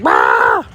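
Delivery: low, loud mock duck squawk; duck scream. Owner imitating the duck screaming at her, mock squawk. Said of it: "the way he screams at me".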